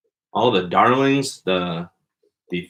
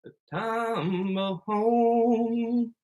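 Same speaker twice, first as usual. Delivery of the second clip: high, slow announcer drawl; 7 dB quieter, much slower than usual. Acted. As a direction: stretch it out like a showman. Podcast intro announcement.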